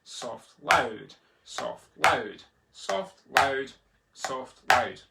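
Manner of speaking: very quiet "soft", punched "loud"; soft-loud alternation. Shown as a demonstration. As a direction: swing rhythmically from hushed "soft" to hard "loud". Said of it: "you can practice that as well".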